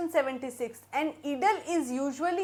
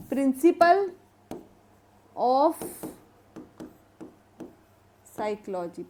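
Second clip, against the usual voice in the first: Principle, of, Psychology with long pauses between; word-by-word dictation; 2 semitones lower, much slower than usual. Shown as a demonstration. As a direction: leave time to write after Principle and after of. Teacher dictates book title in pieces.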